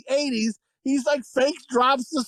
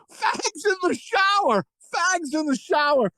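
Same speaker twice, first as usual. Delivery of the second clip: high, slow yelled taunt; mock yell; 7 semitones higher than usual. A guy mocking them in the shower, mock yell. Someone yelling in movie scene.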